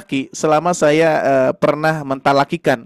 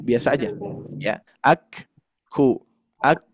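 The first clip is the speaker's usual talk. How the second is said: usual speaking voice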